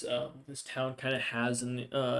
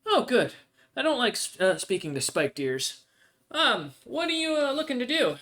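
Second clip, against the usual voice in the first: put-on high-pitched, lively young dwarf woman; young dwarvish barmaid, friendly; 10 semitones higher, 5 dB louder than usual. Barmaid asking a customer what they need, high and friendly. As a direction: play a chirpy barmaid taking an order.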